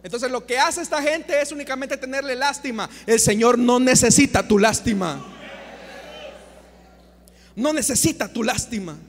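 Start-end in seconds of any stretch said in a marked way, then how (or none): 3.1-5.2 loud, emphatic rebuke; forceful declaration. Real, not acted. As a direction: thunder it at the congregation. Predicador rechaza la lástima con fuerza. (declaración fuerte y tajante)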